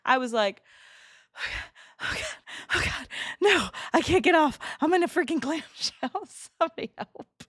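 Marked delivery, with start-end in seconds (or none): 2.0-7.4 high, half-whispered mock panic with laughs; imitating her own panic stuck in a clamshell, laughing. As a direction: ham up being trapped, breathy and high. Retold panic stuck on ride.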